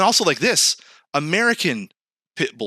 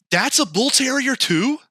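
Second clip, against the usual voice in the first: incredulous high; 11 semitones higher than usual. Real, not acted. Shocked at dog photos.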